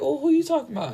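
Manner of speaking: high, feigned innocence; mock innocent. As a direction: ask sweetly, pretending not to know. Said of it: "I'm like".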